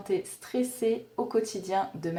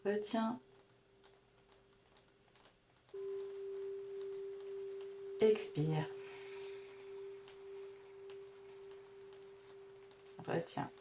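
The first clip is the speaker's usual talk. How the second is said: guided breathing; 11 semitones higher, 11 dB quieter than usual. Acted. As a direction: count the breaths gently, barely above a murmur. (voix douce de respiration guidée)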